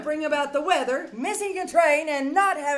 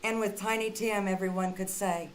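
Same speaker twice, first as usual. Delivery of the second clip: sincere closing address; 7 semitones lower, 6 dB quieter than usual. Acted. Charlotte bids listeners good night, soft and sincere. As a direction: speak gently, like a blessing to the audience.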